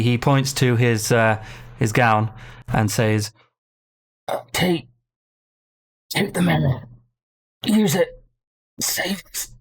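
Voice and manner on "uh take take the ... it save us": broken dying words between gaps; labored breathing. Acted. Dying dwarf points to his gown, labored breathing. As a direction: gasp out a last request. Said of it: "breathing is labored".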